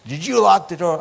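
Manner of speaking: high-pitched imitated question; mock alarm. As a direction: mimic the other man's incredulous asking. Repeats the stranger's alarmed question.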